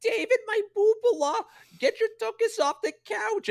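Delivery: very high, loud shrill nagging. Retold or imitated. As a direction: nag your son off the couch. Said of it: "I would do my mom's impression".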